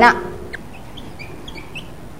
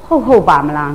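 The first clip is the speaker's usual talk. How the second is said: doubting murmur; 7 semitones lower, 5 dB quieter than usual. Retold or imitated. Teacher acts out a doubting listener, low and soft. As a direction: lower and softer, voicing a listener's doubt. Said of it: "တန်တရားဝင်လာတယ်".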